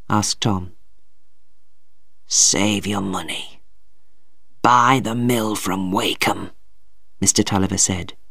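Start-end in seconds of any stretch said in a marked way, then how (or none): 2.3-6.5 slow, low, weak voice; dying father. A narrator's reading. Dying father instructs his son, weakly. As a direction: give last wishes slowly and softly.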